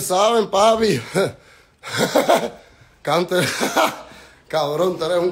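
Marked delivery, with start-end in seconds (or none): 1.8-2.5 high burst of scornful laughter; mocking laugh. Real, not acted. Laughing at rival. 3.4-4.0 high burst of scornful laughter; mocking laugh. Real, not acted. Laughing at rival.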